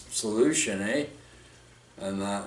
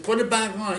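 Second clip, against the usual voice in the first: urgent order; 8 semitones higher than usual. Retold or imitated. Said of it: "I said".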